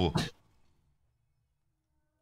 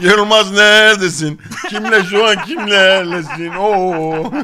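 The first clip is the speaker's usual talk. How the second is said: loud mocking shout; 8 semitones higher, 11 dB louder, slower than usual. Friends yelling mockingly in match.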